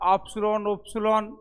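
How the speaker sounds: ऑप्सलॉन, उपसुलॉन with rounded pouting lips; mock the fancy round-mouthed उपसुलॉन; pouted lips, mocking a showy way of saying it. Shown as a demonstration. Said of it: "थोथना गोल करी करी बोलना".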